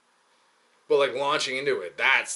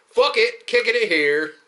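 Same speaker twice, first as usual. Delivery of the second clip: high, loud mock outburst; defiant shout; 10 semitones higher, 9 dB louder than usual. Deciding to stay here with his shadow, mock defiant shout.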